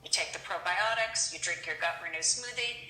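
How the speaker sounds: ordinary delivery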